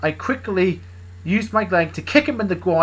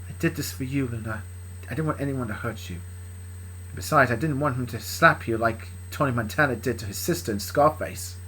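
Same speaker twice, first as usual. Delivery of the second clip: tender reassurance; 2 semitones lower, 6 dB quieter than usual. Acted. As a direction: reassure her gently. Tender reply to Luna.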